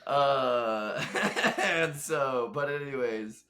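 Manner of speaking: real laughter breaking up the words, high and loud; laughing. Real, not acted. Podcast guest cracking up at own remark, laughing. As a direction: try to carry on through a loud laugh.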